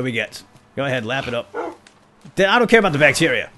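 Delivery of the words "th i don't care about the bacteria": fast, higher and louder snap; exasperated yell. Real, not acted. Snapping at pony drinking.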